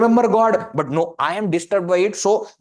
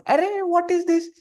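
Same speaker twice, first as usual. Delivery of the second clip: high, mock-exasperated "Arey"; a child's disbelieving retort, pitched high; 11 semitones higher than usual. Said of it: "They just look at me and say".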